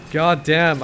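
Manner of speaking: ordinary talk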